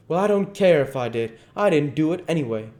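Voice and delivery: loud, high, testy denial; defensive retort. Acted. Duke half admitting his intention, defensive. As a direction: brush off the charge crossly.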